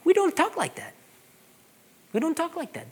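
lightly voiced parent correcting the kids; mild scolding tone to his kids; stern parent. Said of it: "I tell them".

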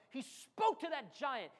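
normal talking voice

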